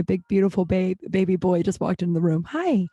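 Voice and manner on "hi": high, sweet little hello; real affectionate coo; cooing greeting. Real, not acted. Greets baby boy entering the room.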